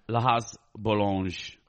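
"La Has Boulange" said slowly, a careful try at a French name; a slow, tentative stab at French for "La Has Boulange"; attempted French. Shown as a demonstration. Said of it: "I know, and I can't".

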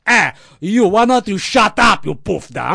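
wrestling heel yelling at fans; heel taunting crowd. Retold or imitated. Heel Lou insulting the crowd.

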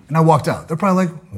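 ordinary talk

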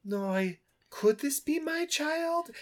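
hammed-up parent asking if it's his child; wondering father. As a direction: draw out the doubt in a high put-on voice. The father wonders about the boy.

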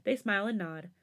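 ordinary delivery

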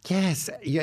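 usual speaking voice

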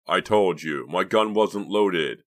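loud, drawn-out protest; loud insistence. A narrator's reading. Boy defends himself to his friends, loudly insistent. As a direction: push each word out forcefully. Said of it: "Clay insisted loudly".

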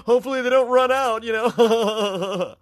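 high, loud, giggly pretentious voice; high-pitched snooty coffee snob giggling between lines; pretentious San Francisco snob. Acted. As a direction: ham up a smug rich hipster gushing through laughs. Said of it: "That's how he talks".

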